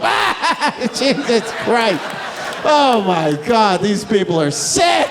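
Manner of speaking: high, slow, laughing mock outcry; hammed-up shocked hilarity. Drunk spectator reacting to a drag show, mock-scandalized shouting. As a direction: wail it out in gleeful mock horror.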